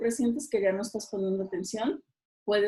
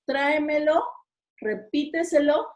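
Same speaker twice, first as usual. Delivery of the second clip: tráemelo and repíteselo pitched up, hitting TRÁ and PÍ; stress before antepenultimate syllable; 6 semitones higher, 3 dB louder than usual. Shown as a demonstration. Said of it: "antes de la antepenúltima". (tráemelo, repíteselo en tono alto, marcando TRÁ y PÍ)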